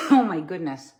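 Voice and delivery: coughing after choking on water; sputtering after water down the wrong pipe. Real, not acted. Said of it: "that water went down the wrong pipe".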